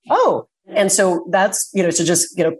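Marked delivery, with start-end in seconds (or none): 0.1-0.5 a high, bright oh of wonder; curious wonder. Retold or imitated. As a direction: discover something playfully.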